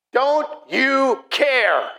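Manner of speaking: high, very slow, word-by-word plea; acted reproach. Believer praying in a hard situation, reproachful cry. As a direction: wail it accusingly, each word stretched. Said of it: "you said to him".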